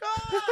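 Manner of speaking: high mock shriek of fright; mock scream. Girlfriend screaming at a cave troll on screen, mock shriek. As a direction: shriek like she saw the troll. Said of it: "She's like".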